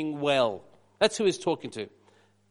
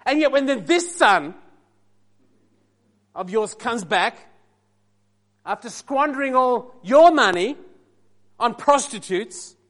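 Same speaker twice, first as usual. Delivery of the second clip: [name]'s sass; 4 semitones higher, 6 dB louder, slower than usual. Acted. Preacher voicing the bitter older son answering his father, sassy and slow. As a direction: lay on the grievance, drawing each phrase out then dropping away. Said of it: "I'm gonna try to give you the right sass".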